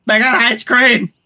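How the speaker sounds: loud, slow excited call; yelling across the room. Real, not acted. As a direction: call it out across the house, thrilled. Calling out find across room.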